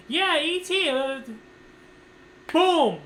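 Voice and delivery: very high chirpy reply, then a loud boom; exaggerated high-pitched retold enthusiasm; mock eager answer cut off. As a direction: re-enact chatting brightly until the lights go out. Re-enacts blacking out under anesthesia mid-answer.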